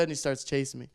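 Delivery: normal talking voice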